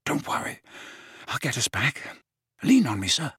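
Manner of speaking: hushed, gentle comfort; soft reassurance. Acted. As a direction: soothe him quietly. Harry reassuring weak Dumbledore.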